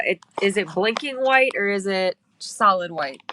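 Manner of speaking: ordinary talk, nothing marked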